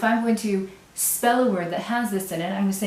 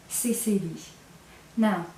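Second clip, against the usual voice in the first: ordinary talk, nothing marked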